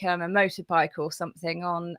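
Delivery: normal talking voice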